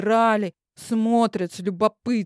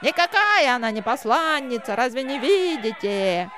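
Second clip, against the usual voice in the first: yelling heckler; 2 semitones higher than usual. Acted. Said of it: "заорал неугомонный критик".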